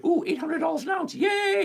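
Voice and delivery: high, giddy cheer imitating a naive investor; mock excited viewer. Trader voicing viewers thrilled by the gold price, mock excitement. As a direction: squeal it like an overexcited gold buyer. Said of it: "you're probably thinking".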